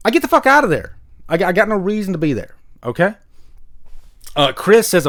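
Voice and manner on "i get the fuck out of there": louder, higher, punchy; fed-up declaration, raised; emphatic. Real, not acted. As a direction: slam the exit shut. Emphatic rant about bad videos.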